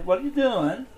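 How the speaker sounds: put-on high sing-song English boss accent; playful imitation of a sing-song English accent. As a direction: ask it in a lilting English accent. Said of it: "ask [name] questions with that same sort of a accent".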